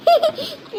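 short high giggle; happy giggle. Acted. Child cheered by aunt's praise, giggling. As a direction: giggle as the tears lift.

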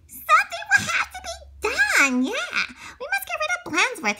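high, giggling conspirator voices; plotting kids. A narrator's reading. Ringleaders plotting on the schoolyard, high and giggly. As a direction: scheme with giggles. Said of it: "ringleaders discussed the situation".

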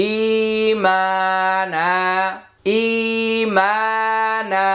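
ই, মা and না each held long; one-alif stretch. Shown as a demonstration. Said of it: "ই এক আলিফ টান".

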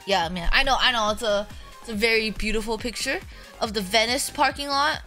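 ordinary delivery

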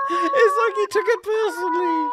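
high-pitched, words squeezed through laughter; helpless giggly delivery; laughing hard. Real, not acted. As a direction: barely get it out for laughing.

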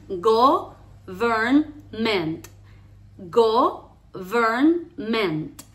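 "government" very slow, in three separate pieces; "government" with long pauses between syllables. Shown as a demonstration. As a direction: break "government" into go, vern, ment. Teacher breaks the word into syllables.

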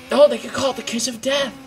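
excited joke. Real, not acted. Delighted joke idea.

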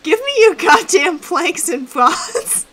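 loud, high, slow, punched-out order; exasperated demand. Real, not acted. Demanding building supplies from a joking friend, exasperated. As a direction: demand it word by word, half laughing.

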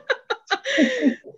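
high, loud laugh that falls away; a burst of nervous laughter. Real, not acted. Remembering the meeting, bursting into nervous laughter.

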